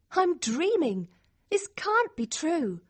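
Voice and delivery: high, wondering voice; amazed disbelief. Acted. Girl stunned by news of winning, amazed disbelief. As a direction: marvel as if in a dream.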